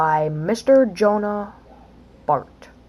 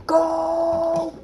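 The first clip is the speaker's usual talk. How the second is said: ordinary talk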